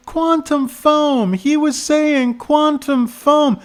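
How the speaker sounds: put-on very high, drawn-out child voice; bespectacled kid. Kid repeating the term for the cop, high slow voice. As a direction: spell it out slowly in a high kid voice. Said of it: "the kid said".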